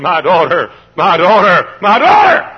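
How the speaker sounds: loving plea. Retold or imitated. Preacher recalling how he called to a straying girl, rising plea. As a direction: call after a lost daughter. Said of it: "I rejoice because I had said so often".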